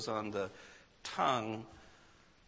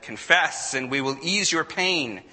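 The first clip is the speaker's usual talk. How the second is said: lightly voiced interrogator, raised voice; louder, higher command from a tribunal; council's demand; 6 semitones higher, 9 dB louder than usual. A narrator's reading. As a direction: read the council's demand firmly and loudly. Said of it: "The council said".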